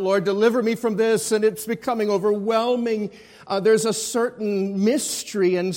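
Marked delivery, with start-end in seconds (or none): 0.0-1.3 louder, fast plea; mild retold cry. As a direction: cry out for rescue, half-acted. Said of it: "when he cries out".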